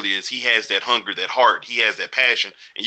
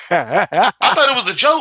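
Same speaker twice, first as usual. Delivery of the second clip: laughing; 8 semitones higher than usual. Real, not acted. Laughing over a funny menu change.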